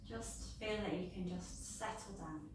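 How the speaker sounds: calm yoga nidra guidance with long pauses; guided meditation. Real, not acted. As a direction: ease listeners down into rest, phrase by phrase.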